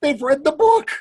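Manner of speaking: high, loud, emphatic inner cry; excited realisation. Retold or imitated. Reader thinking as kids shout along, excited exclamation. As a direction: exclaim it as a retold revelation.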